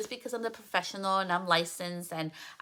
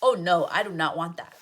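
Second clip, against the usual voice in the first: louder, firm no to the picture; emphatic refusal; 6 dB louder than usual. Retold or imitated. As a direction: push back hard on NOT. Client rejecting a picture.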